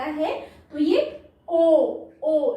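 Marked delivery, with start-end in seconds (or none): none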